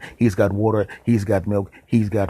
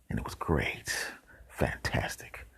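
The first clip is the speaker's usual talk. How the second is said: soft unvoiced whisper, slower; whispered gloat; 8 semitones lower, 11 dB quieter, slower than usual. Real, not acted. Narrator whispering his delight as the spider eats the fly. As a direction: savor it in a hushed whisper. Gleeful asides about fly eaten.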